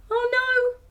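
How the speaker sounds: ordinary delivery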